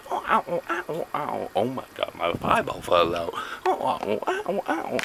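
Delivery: high squeaky ow-oh yelps; hammed-up squeals of pain; mock pain yelps. Vlogger joking about his eyeball, squealing mock ows.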